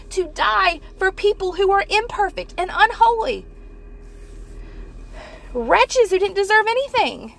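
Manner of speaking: high, loud, fervent preacher proclamation; impassioned preaching. Real, not acted. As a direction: preach it with full fervour, pitched high. Preaches at full force.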